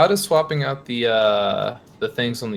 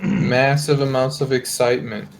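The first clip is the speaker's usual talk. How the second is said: dry, level, mock-excited deadpan; deadpan excitement. Commentator teasing the crew about the match starting, deadpan. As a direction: state huge excitement with none in the voice. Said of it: "could you sound any more excited right there".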